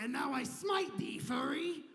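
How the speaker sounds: loud, high, hammed-up smiting God; booming God voice. God shouts a curse at the couple, loud and hammed-up. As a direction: shout it down from heaven, hammy. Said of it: "God was like".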